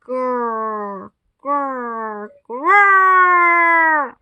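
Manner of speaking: growl, then swell on the last. A narrator's reading. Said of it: "The growling got louder and louder".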